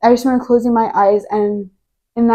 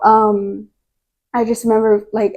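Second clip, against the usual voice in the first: usual speaking voice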